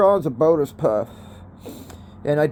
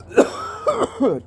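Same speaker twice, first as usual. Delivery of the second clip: hacking smoker's cough; ragged coughing fit; harsh cough. Real, not acted. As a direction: cough it out hard. Coughing after hit.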